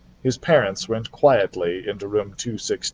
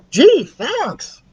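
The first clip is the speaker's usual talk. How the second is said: much higher, brighter mouse voice; high chirpy mouse thanks; 4 dB louder than usual. Acted. Ralph the mouse thanks Keith for peanuts, high and chirpy. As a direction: squeak a grateful thanks.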